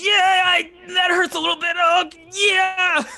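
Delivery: high, loud cheer with a wince of pain. Acted. The wounded guard answers the player, pained loud cheer. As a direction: celebrate through the pain. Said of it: "He goes".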